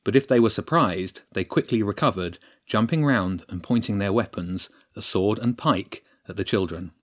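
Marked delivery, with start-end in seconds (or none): none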